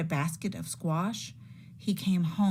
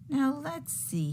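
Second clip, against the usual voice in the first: pondering; 8 semitones higher, slower than usual. Acted. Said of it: "he pondered".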